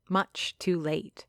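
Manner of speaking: usual speaking voice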